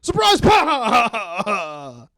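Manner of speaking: high, loud, drawn-out yell; mock surprise shout. Visitor springing out on grandpa, high hammed-up shout.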